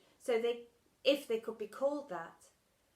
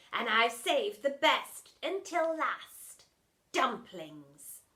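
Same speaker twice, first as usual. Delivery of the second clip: sly villain; 2 semitones higher, 7 dB louder, slower than usual. Acted. Dinner lady sly.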